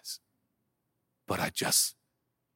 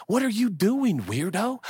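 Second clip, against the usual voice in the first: mock incredulous; 9 semitones higher than usual. Mock scolding the audience.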